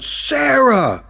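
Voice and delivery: a high, loud shout of her name. Acted. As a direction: yell it so she turns around. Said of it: "I shouted".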